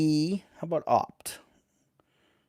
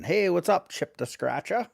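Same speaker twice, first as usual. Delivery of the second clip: usual speaking voice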